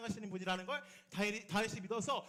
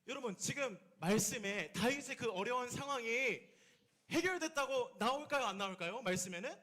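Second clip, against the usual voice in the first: usual speaking voice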